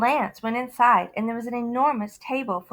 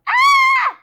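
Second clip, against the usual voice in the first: very high, loud startled shriek; frightened scream; 11 dB louder than usual. Acted. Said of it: "His father yelled".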